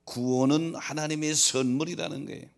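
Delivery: loud, high-pitched preacher's proclamation; full-force preaching. Real, not acted. Preacher proclaiming salvation as God's gift to the congregation, full-force preaching. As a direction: preach it out with driving emphasis.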